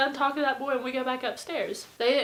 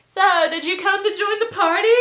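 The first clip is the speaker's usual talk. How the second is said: high, loud and hammed-up eager; eager party invite; 10 semitones higher, 11 dB louder, faster than usual. Party host asking neighbors to join, loudly eager. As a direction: imitate an excited drunk host.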